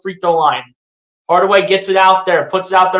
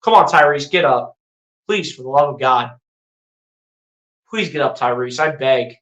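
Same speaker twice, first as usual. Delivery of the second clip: worried plea; 6 semitones lower than usual. Real, not acted. Pleading for injured player.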